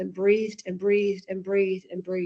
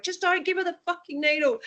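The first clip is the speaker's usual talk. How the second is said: retold yell; 9 semitones higher than usual. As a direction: replay dad's angry yell, half-hearted. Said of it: "he yelled at me".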